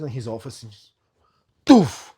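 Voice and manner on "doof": a loud, higher mock collapse sound; comic loud doof; thud noise. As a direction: blurt it out like a body dropping. Slumping down in his office.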